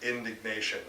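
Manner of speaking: ordinary delivery, nothing marked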